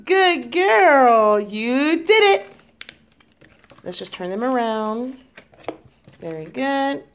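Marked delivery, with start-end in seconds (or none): 0.0-2.5 excited praise. Real, not acted. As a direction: cheer her on. Praising a child at play.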